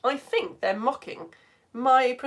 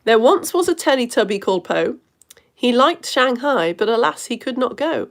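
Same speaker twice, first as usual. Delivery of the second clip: poem reading; 2 semitones higher, 5 dB louder than usual. Acted. Host reading Edmund's poem to viewers, loud recital. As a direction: give the limerick a grand reading.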